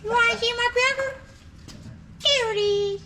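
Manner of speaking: high hammed-up British voice; British accent. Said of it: "all around the world here with the accents".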